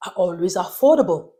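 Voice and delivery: ordinary talk, nothing marked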